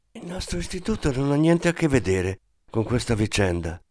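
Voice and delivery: plain reading voice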